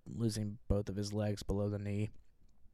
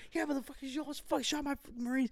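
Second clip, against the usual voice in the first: high, furious screamed scolding; retold angry screaming; 4 dB quieter, faster than usual. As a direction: let him have it, pitched way up. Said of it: "I'm screaming at this kid".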